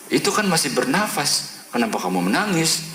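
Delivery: high-pitched retold remonstration; mildly incredulous objection; protesting question. Pastor challenges the weeping family.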